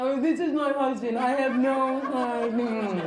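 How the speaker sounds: slow, hunched-over dopey husband; mock a clueless husband, dragging out the end; put-on hunched dimwit; long-married husband voice. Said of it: "hunch over".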